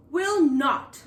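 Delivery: a loud defiant growl; growling back. Acted. Girl refusing the captain, growling back. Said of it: "Molly growled back".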